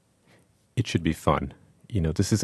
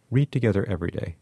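ordinary talk

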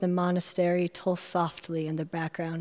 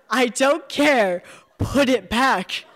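higher, louder, slow, laughing as she insists; laughing insistence; 5 semitones higher, 5 dB louder, slower than usual. Acted. Rose insisting the narrator throw it back, laughing. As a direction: Put it back with a laugh, falling on it.

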